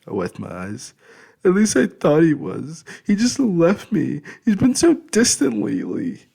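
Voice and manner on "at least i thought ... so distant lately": high, loud crying complaint; tearful. Acted. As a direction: sob it out to uncle. Crying over fiancé leaving.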